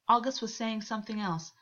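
ordinary delivery, nothing marked

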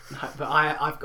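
normal talking voice

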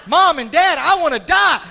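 loud re-enacted outburst of a child; startling wake-up cry. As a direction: burst into the parents' room shouting, acted. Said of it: "that'll wake a dad up like that".

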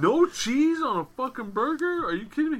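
high, loud incredulous outburst; can't believe what he just heard; outraged disbelief. Retold or imitated. Conrad reacting to a burger with no cheese, high incredulous outburst. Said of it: "Conrad's like".

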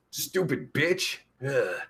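usual speaking voice

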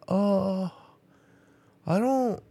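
high, childish mock kid voice; imitating her son. Mother imitating her son asking for his shoes, high mock kid voice.

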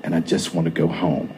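quietly reassuring the angry husband, almost whispering; calm quiet voice. A narrator's reading. Soft calm explanation to Scott so he stays calm. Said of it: "calm, quiet, almost whisper of a voice".

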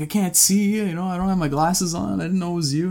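high-pitched protesting; defensive excuse. Retold or imitated. Explaining the missed wave to a friend, high and defensive.

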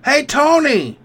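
high put-on call; mock waitress. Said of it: "she'd be like".

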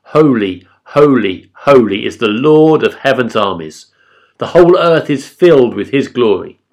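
loud, ringing proclamation with falling cadences; calling out. A narrator's reading. Seraphim calling to each other in worship, calling out. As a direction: call it out in praise, each phrase falling. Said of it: "They were calling out to each other".

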